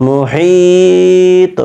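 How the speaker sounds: "মুহিত" drawn out high and steady on the ee; slow recited "মুহিত" with a three-count ee; long stretched vowel. Shown as a demonstration. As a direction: stretch "হি" very long in "মুহিত", then a short bouncing "ত". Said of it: "এবং পড়ব কিভাবে?".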